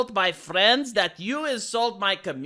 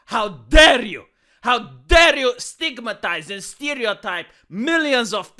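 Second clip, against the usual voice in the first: mock outrage; 5 dB louder than usual. Scolding the studio for insulting fans, loud mock indignation. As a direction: demand it, scandalized.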